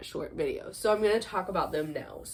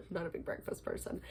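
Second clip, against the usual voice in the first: quiet aside; 9 dB quieter than usual. Real, not acted. Throwaway aside after laughing.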